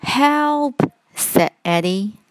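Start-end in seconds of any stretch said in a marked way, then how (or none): 0.0-0.8 high, alarmed little call; frightened cry for help. A narrator's reading. Eddie alone in the dark woods, frightened cry for help. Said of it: "It's dark and horrible in there".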